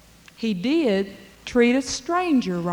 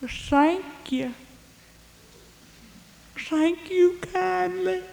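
put-on much higher, slow, frail old beggar voice; old beggar man; 10 semitones higher, 4 dB quieter, slower than usual. Beggar thanking the blacksmith for letting him in, high and frail. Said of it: "said that old beggar man".

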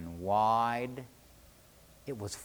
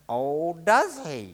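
loud, higher, drawn-out challenge; defiant retort; 4 semitones higher, 6 dB louder, slower than usual. A narrator's reading. Fisherman answering the serpent's order, defiant. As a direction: throw it back slowly and loudly. Charlie defies the serpent's boss.

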